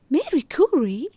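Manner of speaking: high, loud, thrilled outburst; excited exclaim. A narrator's reading. Noor amazed that Marie Curie invited them, exclaiming. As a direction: gasp the name out in delight. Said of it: "Noor exclaimed".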